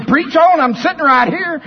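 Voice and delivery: high, loud imitation of Helena; lightly voiced urging; mock Helena. Preacher imitates Helena urging him to keep preaching, high voice. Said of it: "She's just like Peter".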